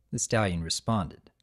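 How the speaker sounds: ordinary delivery, nothing marked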